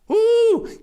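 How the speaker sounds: very high, loud whoop of delight; happy woo. Acted. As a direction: let out a gleeful whoop. Cheering after quiz tease.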